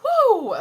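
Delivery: loud high whoo of overwhelm; a real burst of emotion; emotional whoo. Real, not acted. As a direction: let out a shaky rush of feeling. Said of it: "sorry to be emotional".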